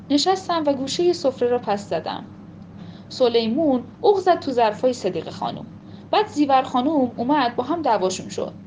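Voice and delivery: usual speaking voice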